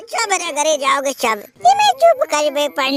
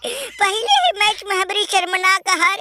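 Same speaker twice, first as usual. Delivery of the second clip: ordinary talk, nothing marked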